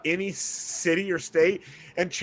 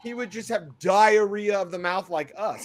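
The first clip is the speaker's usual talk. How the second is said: ordinary talk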